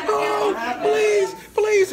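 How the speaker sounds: high, loud pleading yell; retold screaming. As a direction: imitate desperate fans screaming to get in. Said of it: "they was screaming".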